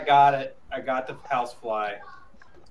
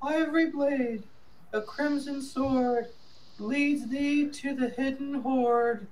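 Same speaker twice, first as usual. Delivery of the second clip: put-on high, slow, quavering old grandma; slower than usual. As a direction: read it like a frail old grandmother. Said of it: "That was my best grandma voice".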